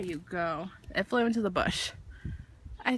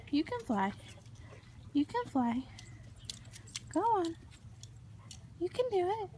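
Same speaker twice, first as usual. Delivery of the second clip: coaxing a wild bird; 5 semitones higher than usual. Real, not acted. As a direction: gently urge the little bird to fly. Coaxing bird to fly away.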